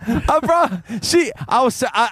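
high-pitched, breaking up with laughter; losing it mid-story; cracking up. Real, not acted. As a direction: struggle to speak through real laughter. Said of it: "I almost lost it".

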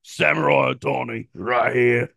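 mock Cockney self-introduction; put-on Cockney accent; Cockney British. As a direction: announce yourself in comic Cockney. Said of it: "Cockney British?".

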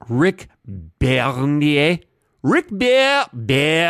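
put-on name said with a French accent. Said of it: "when you suddenly go into a French accent".

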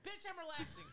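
high-pitched sassy comeback; indignant retort. Retold or imitated. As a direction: retell it as a squeaky, indignant snap. Acts out retort to boyfriend.

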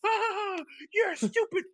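imitated high-pitched tantrum scream; very high mock scream; Ninja screaming. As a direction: screech it like a raging gamer. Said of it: "like, a scream that he did".

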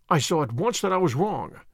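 ordinary delivery

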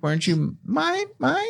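alien voice. Retold or imitated. Imitating the Toy Story aliens.

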